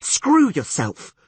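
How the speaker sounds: slow, high, loud scream. A narrator's reading. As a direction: scream it at him. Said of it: "screamed Yennefer".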